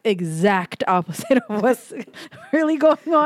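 laughing through the words. Real, not acted. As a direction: breaks into a laugh mid-line. Laughing at own money situation.

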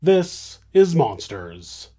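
slow, ominous show title; dramatic title drop. A narrator's reading. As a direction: let the title hang in the dark. Title drop after cold open.

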